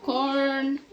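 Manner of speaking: loud call of corn. Real, not acted. Mom calling corn to the kids at breakfast, loud. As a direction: call the word out over the kitchen.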